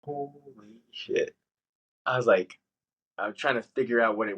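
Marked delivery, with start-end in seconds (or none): 0.0-1.3 slow, soft, hushed "holy shit"; stunned disbelief. Retold or imitated.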